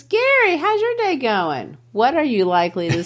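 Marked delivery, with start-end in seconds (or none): 0.0-1.8 high sing-out teacher greeting; cheery teacher. Acted. Teacher asks student Gary about his day, high-pitched greeting. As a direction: pitch it up high for a classroom check-in. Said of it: "And she says".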